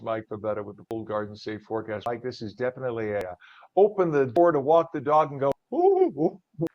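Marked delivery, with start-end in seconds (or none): 5.7-6.7 high cold shivering ooh; shivery ooh. Retold or imitated. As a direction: squeal like the cold just hit you. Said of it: "kind of a morning".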